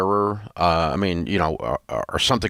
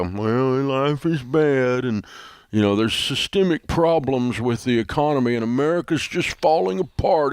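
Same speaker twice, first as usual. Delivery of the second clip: gloomy pessimist; 5 semitones higher than usual. Pretend. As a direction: moan like someone weaned on a pickle. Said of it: "sit on my hands and go".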